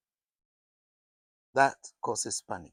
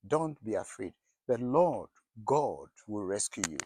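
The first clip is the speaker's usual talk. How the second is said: softer, gentle "Don't be afraid" to comfort; softly reassuring; 5 semitones lower, 4 dB quieter than usual. Shown as a demonstration. Preacher comforting his congregation, softly reassuring. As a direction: ease a frightened listener's fear. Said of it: "the Lord is assuring you".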